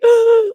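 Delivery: sharp, high mock gasp; audience gasp. Showing how the movie audience reacted, imitated loud gasp. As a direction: imitate a startled crowd's gasp. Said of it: "like, gasp".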